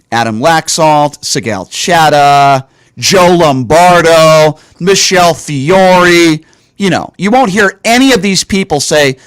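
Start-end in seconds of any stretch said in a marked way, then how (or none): 0.1-6.4 very slow, each name drawn out; pointed roll call. Real, not acted. Host calls out silent Republicans, pointed and drawn-out. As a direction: stretch every name out like an announced list.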